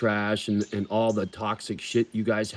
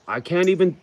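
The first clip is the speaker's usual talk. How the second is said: choked up; 7 semitones higher, 4 dB louder than usual. Real, not acted. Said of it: "instantly I'm in tears".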